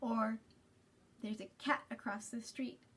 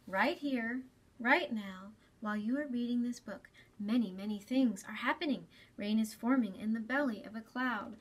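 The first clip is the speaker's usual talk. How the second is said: put-on gentle storybook reading to young listeners; reading to children. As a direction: read it like story time for kids. Reads picture book aloud to children.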